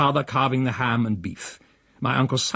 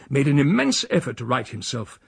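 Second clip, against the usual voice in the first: plain reading voice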